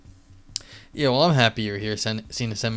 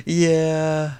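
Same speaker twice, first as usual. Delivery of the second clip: high laugh; 10 semitones higher, 5 dB louder than usual. Real, not acted. Laughing at own police story.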